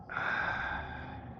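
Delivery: long soft sigh. Real, not acted. Player after a bad move, long sigh. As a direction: let out a long, quiet sigh.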